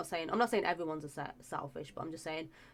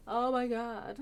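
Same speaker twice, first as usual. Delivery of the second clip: relieved gasp; 6 semitones higher, 6 dB louder, slower than usual. Real, not acted. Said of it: "my chest is really tight".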